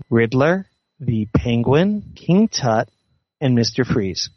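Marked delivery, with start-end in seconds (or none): none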